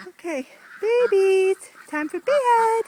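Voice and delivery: high, loud sing-song call to pets. Real, not acted. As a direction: call the babies in a high lilting voice. Calling ducks to bed.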